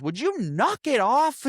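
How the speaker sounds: high, drawn-out retold telling-off; mock exasperation. Bart stopping the sneezing Loki, mock exasperated. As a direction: act out being mildly fed up.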